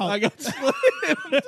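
slow, high laughing retake. Real, not acted. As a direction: laugh through the word slammed. Repeats line while laughing.

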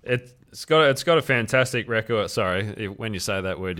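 ordinary talk, nothing marked

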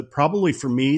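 ordinary talk, nothing marked

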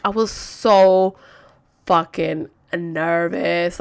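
"so fucking nervous" very slow, word by word; drawn-out emphasis. Real, not acted. Telling viewers about the visit, slow heavy stress on "so fucking nervous". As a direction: stretch "so" and pause before "fucking nervous".

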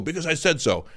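high, exasperated mock parent; acted parental snap; snapping parent. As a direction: shut the kid down as a fed-up parent. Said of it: "You find yourself snapping".

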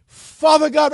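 loud, high preacher's prayer; imitating a pastor calling on heaven; Pastor Wayman Mitchell. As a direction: burst into prayer like a fiery pastor. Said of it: "he sounded like Pastor Wayman Mitchell".

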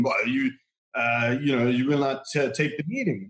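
lightly imitated French accent, halting. Cornered fake reporter stalling, in French accent. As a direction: fumble for words with a touch of French accent. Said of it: "a very distinct French accent".

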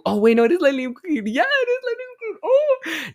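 very high, loud burst of realization; excited squeal. Real, not acted. Podcaster realizes she named the right car, excited squeal. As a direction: squeal it out in sudden delight.

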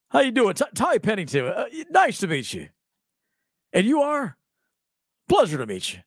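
a hint of an overfriendly host; imitating a chipper greeter. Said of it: "That's how he looks like he talks".

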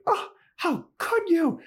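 a melodramatic high fake cry; mock wailing Anya. Podcaster imitating Anya dumped, high mock wail. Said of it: "And then Anya's like".

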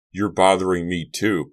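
almost pull off a bully's toughness; would-be tough. A narrator's reading. Bugner copies Malik's threat, trying to sound tough. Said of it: "trying to sound as tough as Malik".

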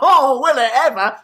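laughing. Pretend. Pretend call, Lamborghini offer.

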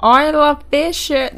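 a badly faked Australian accent on Isla Fisher. Shown as a demonstration. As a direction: try Australian and miss it. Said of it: "That was a terrible".

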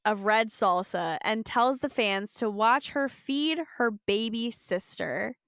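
usual speaking voice

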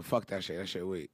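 muttered low and quiet; low grumble. Real, not acted. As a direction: grumble it under your breath. Mock dismissal.

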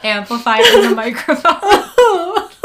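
laughing while talking; amused laugh through words. Real, not acted. Laughing at friend's crying.

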